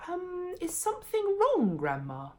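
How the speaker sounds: put-on high, careful boy's voice; boy politely checking on grandma; polite question. A narrator's reading. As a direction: ask sweetly and politely. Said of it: "George asked politely".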